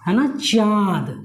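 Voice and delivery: slow, drawn-out चाँद with the vowel through nose and mouth; teacher modelling the nasal vowel in चाँद; nasal chand demo. Shown as a demonstration. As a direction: stretch चाँद slowly so the nasal vowel rings. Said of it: "आप लोग बोलिए".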